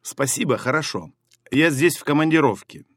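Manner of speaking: ordinary talk, nothing marked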